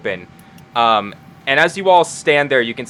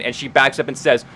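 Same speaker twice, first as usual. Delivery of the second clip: ordinary delivery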